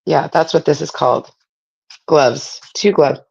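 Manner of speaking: talking while chewing gum; words round a wad of gum. Real, not acted. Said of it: "I'm trying to talk and chew gum".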